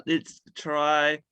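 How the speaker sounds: usual speaking voice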